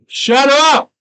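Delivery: very loud, high-pitched yell; sudden full shout to silence someone; explosive interrupting holler. Real, not acted. Breaks off to yell shut up.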